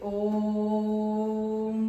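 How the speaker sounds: ओम chanted on one long held note; extra-long vowel. Shown as a demonstration. Teacher showing how the extra-long vowel sounds, chanted at length. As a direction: sustain the O of ओम far beyond a long vowel, ending in a hum. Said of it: "हम लोग इसका उच्चारण करते हैं".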